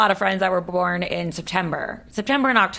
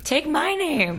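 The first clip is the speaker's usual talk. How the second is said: much higher-pitched, playful insistence; playful mock demand; 9 semitones higher, much slower than usual. Woman to an imagined future husband, playful mock demand.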